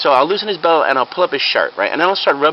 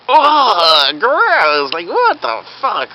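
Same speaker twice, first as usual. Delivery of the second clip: high, drawn-out revulsion; mock disgust; 7 semitones higher, 4 dB louder, much slower than usual. Bystanders hit by a huge stinky fart, mock disgusted. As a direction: recoil loudly from a foul stink.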